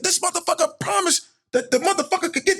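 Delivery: very high, indignant impression of an associate; Dean. Associate reporting the promoter's promise, worked up. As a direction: imitate him building to a shout. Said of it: "That's Dean right there".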